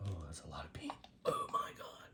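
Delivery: startled whisper at the paint; real alarmed whisper. Real, not acted. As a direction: breathe it out under your breath. Too much paint poured.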